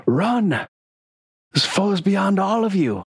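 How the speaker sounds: a cleric ordering everyone to flee; heroic warning. Acted. Vandy shielding the party from the Grave Knight, high urgent cry.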